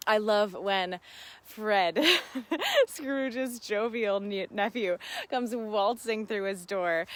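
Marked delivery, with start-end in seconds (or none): 2.1-2.8 giggle. Real, not acted. Laugh at Fred.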